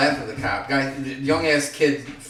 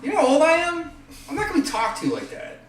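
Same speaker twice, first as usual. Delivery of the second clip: indignant. Retold or imitated. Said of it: "I'm like".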